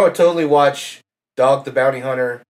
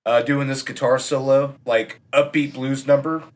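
ordinary talk

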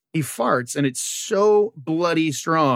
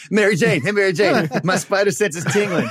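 louder, higher sing-song teasing with a laugh; teasing call; 4 semitones higher, 3 dB louder than usual. Pretend. Peter teasing Mary Jane about his fart, louder and laughing. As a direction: taunt her playfully across the room.

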